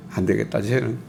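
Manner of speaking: 낮고 작게 포기하듯; 한숨 섞인 체념의 말투; 체념한 혼잣말. Retold or imitated. 하나님의 실망을 흉내. (말을 경홀히 여기는 자를 하나님이 포기하듯 낮게)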